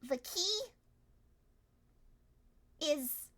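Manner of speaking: ordinary talk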